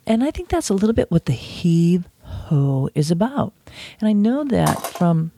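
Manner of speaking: ordinary delivery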